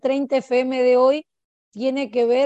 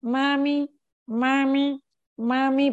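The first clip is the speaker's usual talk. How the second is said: put-on higher little-kid "mami" calls; higher-pitched, repeated child's call; grandson calling mom; 3 semitones higher, slower than usual. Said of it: "mi nieto empieza a decir".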